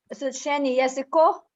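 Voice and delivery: usual speaking voice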